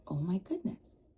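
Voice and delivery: whisper. Retold or imitated. Shocked at unspoiled burger.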